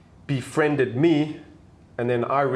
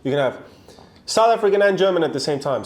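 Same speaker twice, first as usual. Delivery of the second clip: exasperated explaining; 6 semitones higher, 4 dB louder than usual. Real, not acted. Defending dual nationality.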